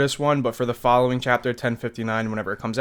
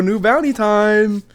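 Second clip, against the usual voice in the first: excited; 6 semitones higher, 8 dB louder, slower than usual. Retold or imitated. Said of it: "And she goes".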